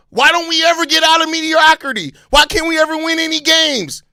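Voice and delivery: high, loud mock complaint; put-on exasperated wail; mock whining fans. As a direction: mimic fans griping helplessly. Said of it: "be, be the first ones".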